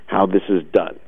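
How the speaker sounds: usual speaking voice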